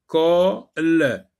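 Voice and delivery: "Colle" cut in two, the final l sounded separately; split into syllables. Shown as a demonstration. Teacher sectioning the word for learners, "Colle" in two beats. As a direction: pause inside "Colle" before a separate "le". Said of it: "on va sectionner le mot".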